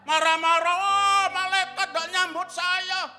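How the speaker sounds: loud, high, drawn-out mock complaint; hammed-up angry whine at full volume; indignant outburst. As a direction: protest loudly like someone offended, stretching the words. Acts out offended soul at heaven's gate.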